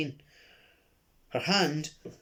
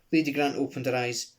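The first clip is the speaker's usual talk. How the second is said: ordinary delivery, nothing marked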